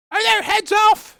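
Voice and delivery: very high, loud shriek; shouting queen. Acted. Queen calling to her soldiers, high shout. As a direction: yell the question shrilly. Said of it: "shouted the queen".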